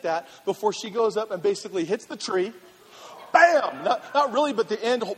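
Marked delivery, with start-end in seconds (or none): 3.3-3.8 loud, high comic impact; imitated crash. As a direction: slam the word out like a collision.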